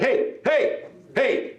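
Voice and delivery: high, short mock calls; calling for attention. Comedian interrupts the crying kid, high sharp calls. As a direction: cut in to stop the crying. Cutting off a screaming child.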